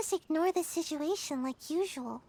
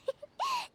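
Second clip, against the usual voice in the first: teasing giggle. Acted. Ninja mocks the beaten villain.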